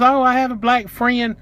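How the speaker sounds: high-pitched mock excuse; mocking imitation. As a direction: imitate her in a high, drawn-out voice. Mimics the clerk's excuses.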